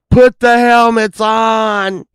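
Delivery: loud, slow, high-pitched command; shouted order. Retold or imitated. Friend ordering buddies into helmets, drawn-out shout. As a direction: drag out a retold yell.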